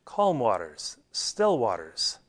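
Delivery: low, soft and slow; hushed, soothing low delivery; calming. Real, not acted. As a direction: let the words settle gently, unhurried. Illustrating calm, still waters.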